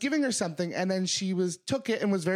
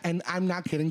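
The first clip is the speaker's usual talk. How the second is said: ordinary delivery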